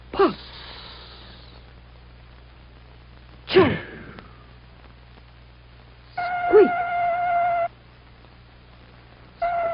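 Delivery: puffing, then a high choo and a squeaking squeal; a last very high thin squeak as it stops; breakdown train noises. Acted. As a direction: sputter like an engine dying.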